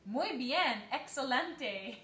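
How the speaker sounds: playful Spanish flourish; exclaimed in Spanish. Retold or imitated. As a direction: exclaim it with relish, imitating a delighted kid. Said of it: "she exclaimed".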